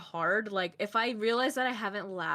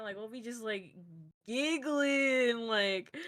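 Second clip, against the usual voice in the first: ordinary delivery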